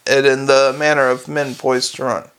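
ordinary delivery, nothing marked

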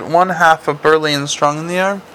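usual speaking voice

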